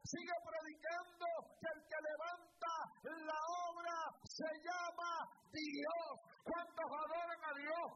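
loud, high, slow pulpit preaching; fiery preaching. Acted. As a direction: preach it out to the whole church at full force. Preaches at full force.

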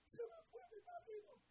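high, wailing mock lament; imitating crying wife. Pastor mimicking a wife who came crying, high mock wail. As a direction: play the abandoned wife, whimpering. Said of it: "vino a llorar".

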